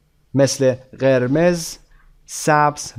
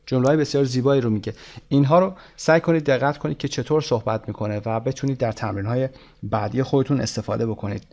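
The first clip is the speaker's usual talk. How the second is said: ordinary talk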